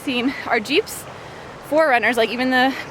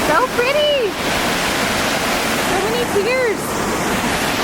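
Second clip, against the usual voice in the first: delighted; 11 semitones higher, much slower than usual. Real, not acted. Delighted at the scenery.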